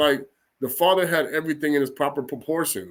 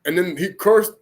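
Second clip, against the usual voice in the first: normal talking voice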